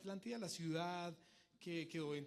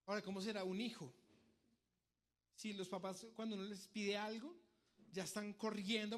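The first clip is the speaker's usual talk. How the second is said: normal talking voice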